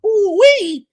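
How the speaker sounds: high, loud ooh-wee holler; excited whoop. Real, not acted. Host opens the show for the audience, loud excited whoop. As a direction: let it rip like the party's starting.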